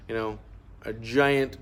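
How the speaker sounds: ordinary delivery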